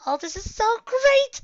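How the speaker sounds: very high, loud happy squeal; overjoyed squeal. Acted. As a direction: burst out with pure joy. Filly overjoyed.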